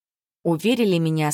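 plain reading voice

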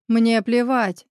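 plain reading voice

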